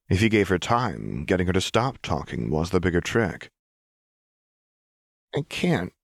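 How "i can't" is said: put-on high, strained child voice; young girl near tears, pitched up; Powder, small and choked. A narrator's reading. Narrator voicing Powder to Silco, high and choked. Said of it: "she finally said".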